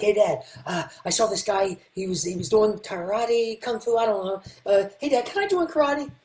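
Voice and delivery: lightly voiced boy pleading to join; eager young son. Thirteen-year-old asking his dad to join karate, lightly eager. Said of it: "I ask my dad".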